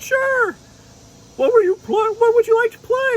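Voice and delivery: very high, chatty unicorn; cheerful little unicorn explaining; unicorn puppet voice. Pretend. Unicorn puppet answering the dragon, very high. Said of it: "[name] said".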